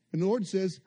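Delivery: ordinary talk, nothing marked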